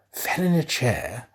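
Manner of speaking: ordinary delivery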